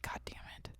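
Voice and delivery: muttered under breath, barely voiced; whispered curse. Annoyed at a Celsius thermometer, whispering. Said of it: "I was just like".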